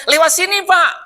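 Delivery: high, insistent guiding call. Retold or imitated. Father guiding a stranger across tracks, calling high. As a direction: call someone over this way.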